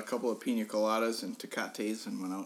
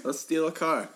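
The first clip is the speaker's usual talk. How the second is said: louder, higher repeat of the gag; loud joking proposal; 7 semitones higher, 6 dB louder than usual. Retold or imitated. Repeating the joke for the crowd, loud. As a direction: belt it out as a joke.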